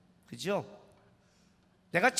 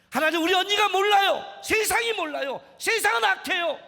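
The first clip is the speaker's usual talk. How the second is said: high, loud pleading cry; 높고 크게 간절히 외치는 기도; impassioned prayer; 9 semitones higher, 7 dB louder than usual. Acted. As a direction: cry out to God with full force.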